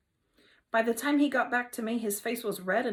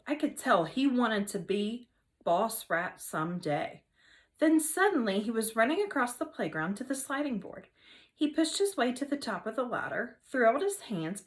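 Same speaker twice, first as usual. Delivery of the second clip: ordinary delivery, nothing marked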